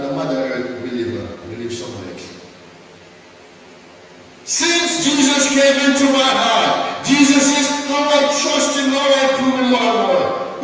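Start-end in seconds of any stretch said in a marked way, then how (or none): 4.4-10.6 high, loud, fervent preaching; a rising shout of faith over the music; full-force preaching. Acted. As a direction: preach it out to the whole church.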